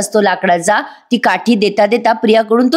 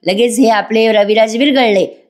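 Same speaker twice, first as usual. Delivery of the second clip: normal talking voice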